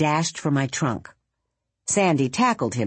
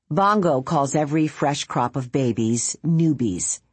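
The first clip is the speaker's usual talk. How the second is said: plain reading voice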